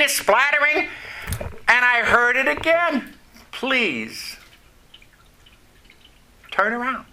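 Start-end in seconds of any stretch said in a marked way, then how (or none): 3.5-7.1 gentle plea. Retold or imitated. God's gentle instruction retold.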